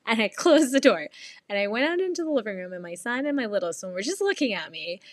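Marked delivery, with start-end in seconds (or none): none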